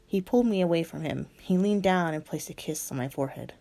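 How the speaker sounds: usual speaking voice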